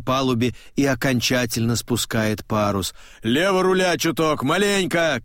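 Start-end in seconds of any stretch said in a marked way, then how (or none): 3.2-5.2 higher called order; shout. A narrator's reading. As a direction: call a small correction across deck. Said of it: "кричит он". (крик рулевой команды)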